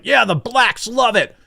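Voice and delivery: high, drawn-out mock enthusiasm; mock media cheering. Host mocking how the media reacts, mock cheering. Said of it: "the media's like".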